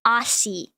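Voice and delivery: Aussie with an s, "AH-see"; AUSSIE with a hissed s, no z; wrong pronunciation. Shown as a demonstration. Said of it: "not Aussie".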